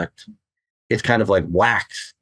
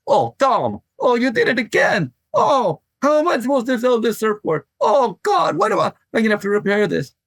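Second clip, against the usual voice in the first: very high-pitched, hammed-up exasperated wail; Donald Takayama impression; 14 semitones higher than usual. Board maker scolding Tom over a delaminated board, high mock exasperation. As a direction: imitate a board maker fretting in a high, put-on voice. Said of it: "Donald would say".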